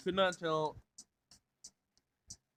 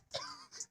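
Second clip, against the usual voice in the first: high breathless laugh; a squeaky unvoiced burst of laughter; disbelieving laugh; 13 dB quieter than usual. Sam laughing at the robot's joke count, breathless.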